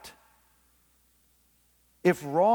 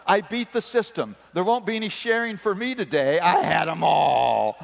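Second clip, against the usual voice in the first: put-on crowing triumphant child, loud and pitched up; utter victory; 5 dB louder than usual. Son gloating to Daddy over the toys, triumphant. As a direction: gloat like a kid who won it all. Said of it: "with a look of utter victory".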